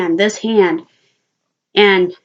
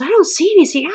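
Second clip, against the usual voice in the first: high-pitched dismissive quote; high voice of a baffled grandmother; retold grandmother; 9 semitones higher, faster than usual. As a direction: imitate grandmother's denial, pitched up. Retold grandmother denying she sees it.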